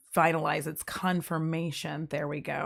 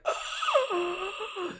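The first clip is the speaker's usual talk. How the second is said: sharp gasp for air; 14 semitones higher, 6 dB quieter than usual. Retold or imitated. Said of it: "I'm like".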